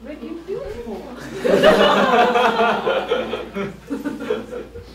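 long high burst of laughter. Real, not acted. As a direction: laugh at the silly claim. Laughs at own example.

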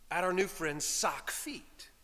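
ordinary talk

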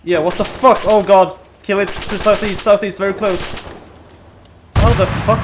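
loud, frantic yelling; panicked shouting. Real, not acted. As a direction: yell it out under fire. Taking fire, yelling directions.

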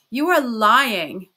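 loud, slow roar of accusation; unrestrained roar. A narrator's reading. Raskolnikov faces the locked door, roaring without restraint. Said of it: "roared Raskolnikov without restraint".

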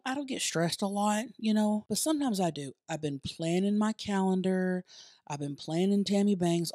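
ordinary talk

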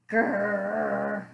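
low drawn-out growl; bear growl. Acted. Mysterious sound over the windowsill, low bear growl. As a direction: rumble it deep from the belly. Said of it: "Grrr rumbled from the bear's belly".

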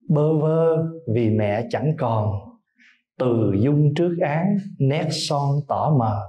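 slow, tender poem reading; poem recitation. Acted. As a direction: recite each line with lingering pauses. (ngâm thơ chậm rãi, tha thiết)

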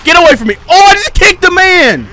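high loud yell of fright; real frightened shout; panicked shout. Real, not acted. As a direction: scream at it to back off. Enemy lunges at player.

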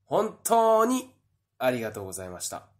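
ordinary talk, nothing marked

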